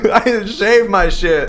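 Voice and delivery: high, loud words through real laughter; cracking up mid-sentence; laughing. Real, not acted. Streamer cracking up at his own hairless armpits, laughing.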